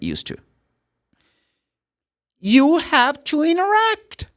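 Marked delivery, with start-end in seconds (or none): 2.4-4.3 high-pitched, slow emphatic insistence; high, drawn-out teacherly insistence; emphatic urging. Real, not acted. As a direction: urge the class slowly, voice pitched right up. Teacher exhorting the students.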